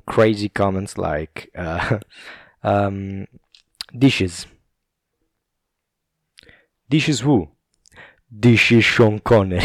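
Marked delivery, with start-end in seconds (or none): 4.0-9.6 loud mock Scottish, every S as "sh"; hammed-up Scot slushing "this is" into "dishes"; Sean Connery. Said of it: "so funny because the way he says".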